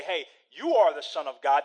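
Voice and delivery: emphatic, loud declaration with "Son of God" as fact; "you are the Son of God" as a pointed statement; stated, not questioned. Acted. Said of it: "There's no question mark behind his statement".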